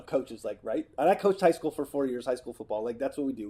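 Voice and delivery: ordinary delivery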